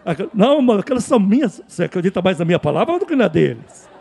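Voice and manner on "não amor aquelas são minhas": quick, higher, louder protest; protesting in a hurry, pitched up; acted hasty denial. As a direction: blurt out a mock excuse to your partner. Acted excuse to a spouse.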